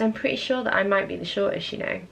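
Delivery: ordinary talk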